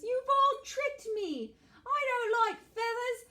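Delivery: very high and loud wail; outraged dingo. Acted. Dingo accusing the animals of tricking him, high and outraged. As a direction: whine in betrayal.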